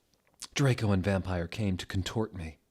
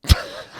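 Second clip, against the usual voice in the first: laughing; 9 dB louder than usual. Real, not acted. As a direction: burst of breathy laughter. Reader breaks up laughing.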